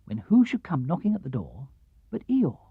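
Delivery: plain reading voice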